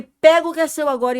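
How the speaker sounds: high, loud command to the devil; loud rebuke. Retold or imitated. Rebuking Satan again, loud high command. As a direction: hurl the order at him. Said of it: "Aí eu falei alto com Satanás".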